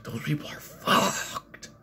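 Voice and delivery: slow Canadian impression, swear stretched into a whisper; breath-heavy, drawn-out mock Canadian accent; thick Canadian accent. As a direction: repeat it as a hammed-up Canadian accent. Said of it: "thick Canadian accent".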